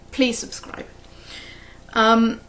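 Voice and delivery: ordinary delivery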